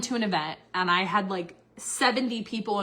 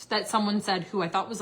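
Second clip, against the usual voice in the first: normal talking voice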